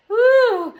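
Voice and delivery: loud, high whew of relief; relieved whew. Pretend. Picturing a good outcome, loud relieved whew. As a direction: let out a big exaggerated whew.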